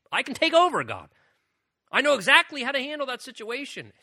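high, loud, hammed-up exasperation; cocky impatience. Believer offers to take over for God, comically impatient. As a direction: play a cocksure helper pushing God to hurry.

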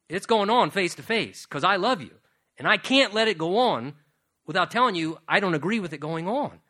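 loud, fast, driving preacher's push; preaching at full force, loud and quick; forceful preaching. Acted. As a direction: hammer it home to the congregation. Preacher pressing the point, rising.

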